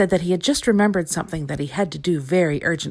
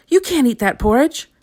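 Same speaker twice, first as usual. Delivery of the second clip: higher, urgent protest; alarmed cry; 7 semitones higher, 5 dB louder than usual. A narrator's reading. Little Miss Sunshine warning Mister Greedy off, urgent cry. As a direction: stop him before he eats. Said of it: "cried Little Miss Sunshine".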